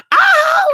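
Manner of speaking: sudden very high yell; high yelp. Real, not acted. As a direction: let out a sharp high ah. Sudden shout.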